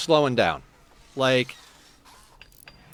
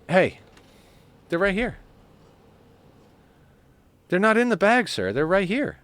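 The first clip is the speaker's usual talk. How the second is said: much higher, sing-song coaxing of a kitty; high pet talk; 7 semitones higher than usual. Acted. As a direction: lure the cat to the treats in your hand. Coaxing cat with treats.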